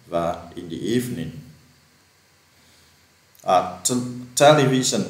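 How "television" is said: television with TE stressed, the rest light; stress on the first syllable. Shown as a demonstration. Teacher showing students the right stress in television. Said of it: "từ này nhấn âm đầu nè".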